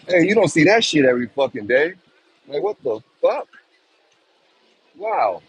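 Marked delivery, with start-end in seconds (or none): none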